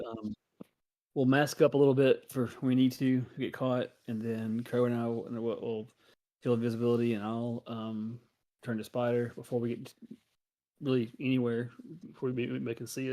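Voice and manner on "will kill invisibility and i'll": ordinary talk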